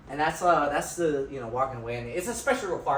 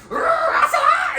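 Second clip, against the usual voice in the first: mock rage, a much higher, louder scream of the name; furious shout; 9 dB louder than usual. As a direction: scream the baby's name in hammed-up fury. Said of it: "he gets so angry".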